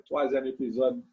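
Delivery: ordinary talk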